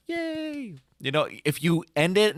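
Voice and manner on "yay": very high, soft little cheer; a tiny cheer from the crowd; mock crowd cheer. Mimicking the audience cheering at the end, high. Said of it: "everybody's like".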